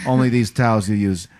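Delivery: a hint of a New York Italian mobster; Italian tough guy. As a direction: press the point like a wiseguy. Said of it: "Italian dude comes in".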